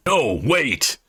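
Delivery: mild shout. Acted.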